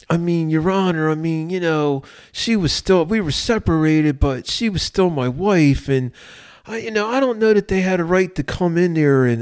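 high-pitched, hesitant mock excuse-making; protesting defendant. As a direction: imitate a defendant hemming and hawing to the judge. Defendant pleads excuses to judge.

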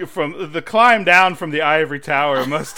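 rising into high, loud laughter; teasing line dissolving into laughs; laughing tease. Pretend. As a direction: rib them while cracking up.

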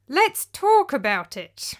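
slow, pressed, held-back anger; through gritted teeth. Acted. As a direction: force the words past clenched jaw. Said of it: "I said through gritted teeth".

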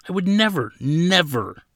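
high, emphatic protest; indignant denial. A narrator's reading. Accused prisoner denies poaching, indignant.

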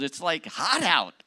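mock his own griping, pitched high; hammed-up moaning about the heat; whiny complaining. Retold complaining on the drive.

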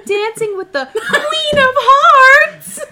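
very slow, loud, high put-on voice; Hillary. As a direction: belt it out high, stretching every word. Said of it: "That's not Hillary's voice".

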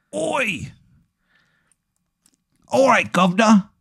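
high, squawky bad Cockney accent; terrible British accent. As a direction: put on a hammed-up London accent. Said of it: "He's got a terrible accent".

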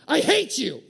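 hammed-up blurted hatred; mock outburst. Inner voice snapping at friend over food, mock shout. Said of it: "passive-aggressive inner voice".

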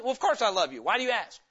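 irritated, defensive reassurance; frustrated husband. Acted. Husband answers wife's doubt, exasperated. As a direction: answer as if the question annoys you. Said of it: "is he frustrated with her".

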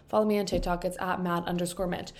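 ordinary talk

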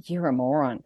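low, quiet deadpan verdict; retold withering aside; deadpan insult. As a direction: drop the pitch, unimpressed.

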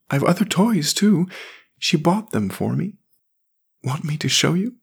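put-on high, boyish voice of a sad little boy; young boy voice. A narrator's reading. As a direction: read it as a small forlorn boy. Jamie speaks.

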